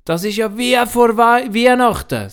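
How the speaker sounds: put-on a child thrilled like before Christmas; boy Juro. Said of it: "sagt der Juro".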